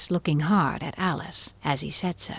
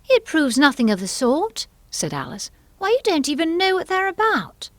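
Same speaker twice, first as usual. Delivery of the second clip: indignant Alice; 11 semitones higher than usual. A narrator's reading. Alice contradicts the Queen.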